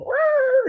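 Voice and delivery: very high, loud cat cry; imitated cat yowl. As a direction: squeal like a scolded cat running off. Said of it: "and then he go".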